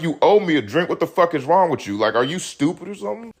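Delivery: high, indignant retold scolding; mock angry. Scolding the friend who won't share his drink voucher, high and indignant.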